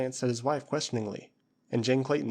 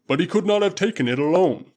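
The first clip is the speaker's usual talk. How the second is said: booming Waziri warrior; 6 semitones higher, 8 dB louder than usual. Acted. Usula answering his master Tarzan, loud and proud. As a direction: call it out like a loyal tribesman.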